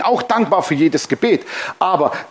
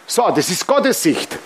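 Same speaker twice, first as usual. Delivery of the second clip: usual speaking voice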